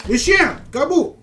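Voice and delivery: high loud call; calling the dog. Real, not acted. Streamer yelling for his dog companion. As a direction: shout for the dog across the map.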